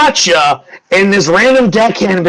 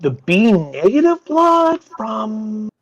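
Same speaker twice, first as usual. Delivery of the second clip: slow, musing noblewoman; 5 semitones higher, 5 dB quieter, much slower than usual. Acted. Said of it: "she goes".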